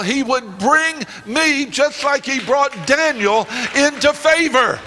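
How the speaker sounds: building, fervent preaching climax; roaring rallying speech. Acted.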